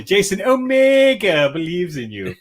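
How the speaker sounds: put-on teasing a Kiwi accent you can't stand; mock Kiwi accent. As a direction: parody the New Zealand girl's earnest line in a Kiwi accent. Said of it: "Dragon Buddy".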